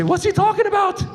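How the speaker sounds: high, panicked question; alarmed passenger. Acted. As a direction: blurt it out in mock alarm. Alarmed passenger overhearing Arabic.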